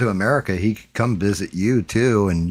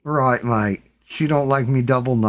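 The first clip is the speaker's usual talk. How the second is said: mock British accent; 5 semitones higher than usual. Mocking the Brit again.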